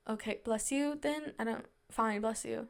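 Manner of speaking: softly muttered, retold reluctant reply; grudging. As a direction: mutter it low-key, as if giving in unwillingly.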